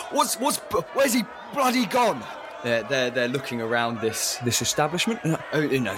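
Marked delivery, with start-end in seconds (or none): 0.1-2.2 high, shouting thug; angry thug shout. Acted. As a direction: bark it like an angry heavy. Club-wielding thugs searching tavern.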